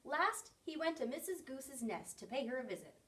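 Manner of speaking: usual speaking voice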